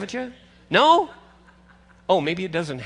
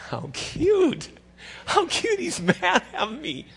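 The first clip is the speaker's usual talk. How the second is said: higher, chuckling through the words; amused grandpa; 9 semitones higher than usual. Retold or imitated. Grandpa watching his mad grandson, laughing fondly.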